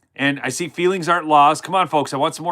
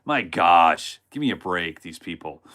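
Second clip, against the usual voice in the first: scoffing dismissal; 12 semitones lower than usual. Real, not acted. Scoffing at crying protesters.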